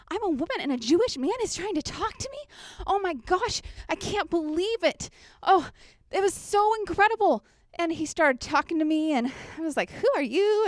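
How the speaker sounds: high, breathless astonishment; overwhelmed by meeting him; amazed Samaritan woman. Acted. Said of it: "as that Samaritan woman".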